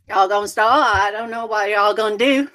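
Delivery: run-together Southern speech, endings swallowed; Southern dialect. Retold or imitated. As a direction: slur it like a Carolina father-in-law. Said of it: "the dialect of Southern".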